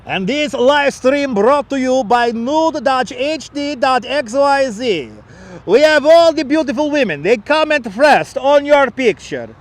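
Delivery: mock announcer plugging a dating site; mock ad read. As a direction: sell it like a cheesy late-night ad. Fake sleazy sponsor ad read.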